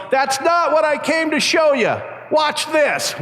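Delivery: high, strained call upward; imitated yell. Eddie's boast to friends up the hill, imitated yell. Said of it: "He looks up and he yells".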